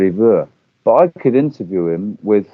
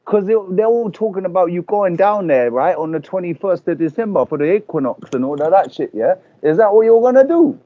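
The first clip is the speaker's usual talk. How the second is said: brash London lad calling a stranger; Joaquin, London lad; 5 semitones higher than usual. Acted. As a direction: play a brash London bloke on the phone. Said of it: "he's very brash".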